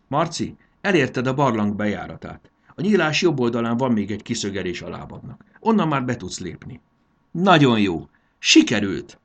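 thrilled, projecting coaching voice; excited encouragement. A narrator's reading. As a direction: call out to guide and praise a climber. Fairy cheers climber on in story.

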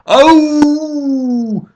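long, very high loud howl; howl of pain. A narrator's reading. Dragon is kicked in the tail, howling in pain. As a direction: howl out a drawn-out yelp. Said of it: "üvöltötte".

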